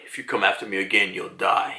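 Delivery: low, quick, quiet threat; stern. Retold or imitated. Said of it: "he's always been this stern".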